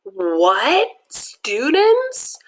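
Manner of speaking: high stunned disbelief; shocked. Acted. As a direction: react in total shock. Stunned kids react.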